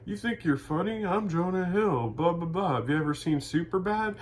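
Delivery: high, cocky mocking bro voice; mock Jonah Hill. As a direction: sneer it up high like a smug jerk at the bar. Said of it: "and he's like".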